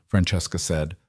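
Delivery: usual speaking voice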